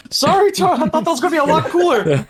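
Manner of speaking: real laughter in a high voice; laughing apology. Real, not acted. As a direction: apologize while cracking up. Laughing apology after own failed move.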